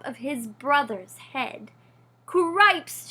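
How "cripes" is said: a loud, alarmed cry; shouted. A narrator's reading. Panicked mouse shouts at what he sees, alarmed shout. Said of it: "shouted Furlow again".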